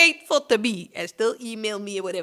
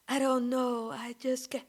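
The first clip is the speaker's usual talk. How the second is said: much softer, breathy limp "I don't know, I just can't"; mock killer's soft voice; 2 semitones lower, 14 dB quieter than usual. Vlogger imitating the woman on a first date, much softer. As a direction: fake a hollow, helpless mumble. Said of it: "she talked like this in real life".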